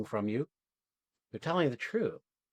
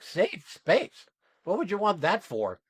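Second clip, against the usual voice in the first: higher, disbelieving echo of the request; incredulous retort; 6 semitones higher than usual. Retold or imitated. Coach retorting to students who want safety, incredulous. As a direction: throw it back as an absurd question.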